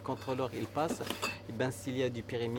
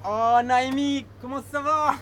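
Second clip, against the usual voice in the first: loud, high, exuberant hello; big high-pitched welcome; delighted greeting; 11 semitones higher, 8 dB louder than usual. Real, not acted. Greets a friend coming in.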